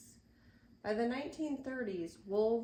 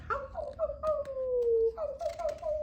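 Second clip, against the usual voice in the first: wolf howl; 9 dB louder than usual. Pretend. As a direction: howl up at the moon. Imitates wolves howling.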